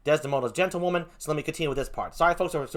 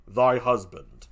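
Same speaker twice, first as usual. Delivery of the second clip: low, falling confirmation; grim Othello; 4 semitones lower, much slower than usual. Acted. Othello confirms to Emilia, grim. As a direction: state it deep and final.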